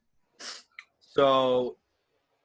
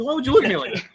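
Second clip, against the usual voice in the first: high, loud, incredulous retort; mock indignation; 12 semitones higher, 7 dB louder, much faster than usual. Driver questioning the officer's look, mock indignation. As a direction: protest in a squeaky pitched-up voice. Retells his reply to puzzled officer.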